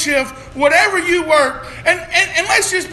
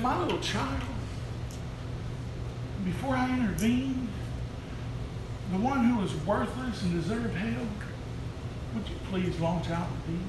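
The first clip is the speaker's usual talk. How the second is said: humble plea; 7 semitones lower, 14 dB quieter than usual. Acted. Preacher voicing the Lord's plea.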